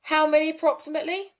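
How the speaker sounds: acted emergency operator. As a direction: play the operator checking a number. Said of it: "thank you very much for your acting skills".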